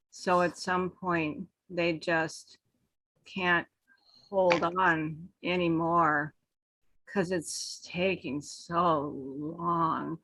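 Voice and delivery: very slow, soft, dragging delivery that trails away; slow droning. Shown as a demonstration. Speaker showing the panel how slow talk hypnotizes, slow soft drone. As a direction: stretch every word out until the room drifts off. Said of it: "You hypnotize them by talking like this".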